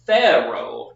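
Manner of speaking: PHA-raoh, first syllable loud, second falling; firm demo stressing PHA in "Pharaoh". Shown as a demonstration. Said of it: "not Pharaoh".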